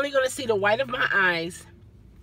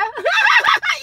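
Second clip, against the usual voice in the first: loud high laugh; 7 dB louder than usual. Real, not acted. Comedian laughs at her own joke, loud and high.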